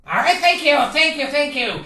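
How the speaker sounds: high, loud gushing gratitude; delighted thanks. Acted. Gatekeeper thanks the visitor who named him, delighted. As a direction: gush with grateful glee.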